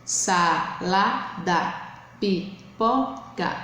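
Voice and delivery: slow, "Salada" and "pipoca" in separate beats; syllable by syllable. Shown as a demonstration. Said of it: "pra você ver o número de sílaba". ("Salada, pipoca" devagar, separando as sílabas)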